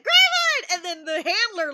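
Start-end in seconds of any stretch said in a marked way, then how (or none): 0.0-0.7 loud high call across the room; excited shouted greeting; shouted name. Retold or imitated. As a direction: yell his name out happily. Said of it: "before I said".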